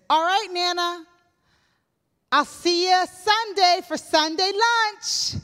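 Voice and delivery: high, bright, eager farewell; cheery goodbye. Retold or imitated. Newlywed cheerfully saying goodbye to Nana, high and eager. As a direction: chirp it out to Nana with a big smile. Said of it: "I said".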